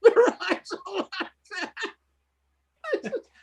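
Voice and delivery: high laughing voice; laughing through it; giggling while talking. Real, not acted. Laughing telling story.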